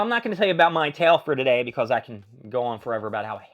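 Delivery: ordinary delivery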